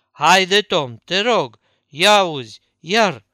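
loud, urgent begging; frantic pleading. Acted. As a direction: beg him to look, frantic and loud. Huck begs Tom in terror. (Huck îl imploră pe Tom să se uite, tare și panicat)

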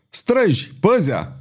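high-pitched warning call; narrated hero's booming warning; thundered warning. A narrator's reading. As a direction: warn the guards with a ringing cry. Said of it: "tună Pardaion".

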